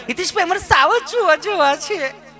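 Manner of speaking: high, prissy mock city woman; mimicking city lady. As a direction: put on a high, fussy tourist voice.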